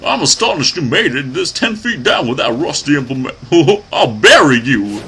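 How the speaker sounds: high-pitched hillbilly villain voice; hillbilly accent. Acted. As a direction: voice a cackling hillbilly boss. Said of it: "I don't know why I gave him a hillbilly accent".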